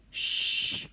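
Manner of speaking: whispered shush all together; hushing. A narrator's reading. Everyone hushing the bears. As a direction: hush them, urgent. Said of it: "said everyone".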